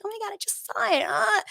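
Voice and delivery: high, airy mock apology with a laugh; fake innocent. Mocking the coworker's fake surprise over the email. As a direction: play the coworker pretending she only just saw it. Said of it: "Bitch, don't gaslight me".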